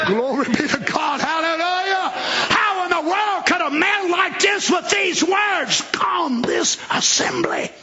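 high, stretched-out revival shout; slow, high, ringing pulpit cry; exultant preaching. Acted. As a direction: marvel aloud to the congregation. Preaches at full force.

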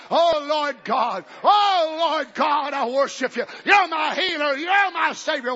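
loud, fervent praise, drawn out at the start; worship prayer demo. Shown as a demonstration. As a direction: show them how to begin prayer. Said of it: "how do you begin prayer?".

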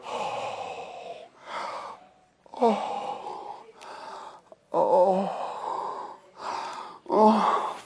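soft, breathless grieving oh's, almost voiceless; grieving groans. Acted. God grieving over his people, in breathy soft groans. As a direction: sigh them out like a heartbroken father.

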